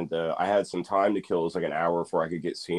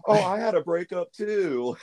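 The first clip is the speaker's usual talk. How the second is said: higher, slow whiny mock; mocking fan voice; 13 semitones higher than usual. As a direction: mimic a sappy fan, slowly. Mocking [name] fans.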